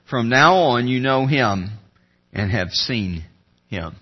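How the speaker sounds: measured public reading of scripture. Acted. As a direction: read each verse with reverent weight. Reads the scripture passage aloud.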